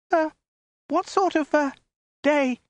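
very high, hesitant falsetto; terrified woman groping for words; strangled squeak. A narrator's reading. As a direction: squeak it out haltingly, with frightened gaps. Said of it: "she said in a hesitant, strangled squeak".

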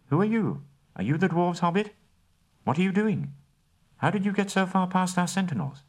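high, quick string of elven questions; demanding elf guards. Acted. Elf guards grilling the captured hobbit, high and pressing. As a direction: pin the stranger down with questions.

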